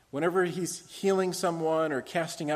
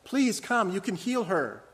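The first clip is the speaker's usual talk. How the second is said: higher, louder urgent plea; desperate father; 5 semitones higher, 5 dB louder than usual. Acted. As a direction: beg Jesus to hurry. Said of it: "Jairus came to Jesus one day and said".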